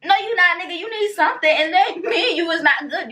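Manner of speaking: very high-pitched, indignant comeback; mock confrontation. Answering the man who says he's good, mock confrontation. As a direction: challenge him in a high, incredulous voice. Mock challenge to the man's reply.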